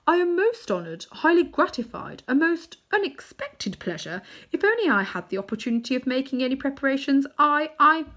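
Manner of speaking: high, flustered, oily flattery; bow and scrape with every word; fawning old man. A narrator's reading. Uncle Andrew grovelling before the witch queen, high and fawning.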